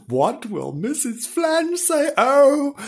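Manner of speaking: high, slow wail of dismay; comic dread. Acted. Hatless husband dreads his wife.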